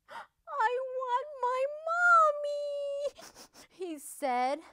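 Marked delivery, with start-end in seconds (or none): 0.1-3.1 very high, slow little wail; tearful whine. A narrator's reading. Weeping number five wanting his mother, drawn-out tearful whine. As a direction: wail it as a crying baby duck. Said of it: "a fat little tear rolled down".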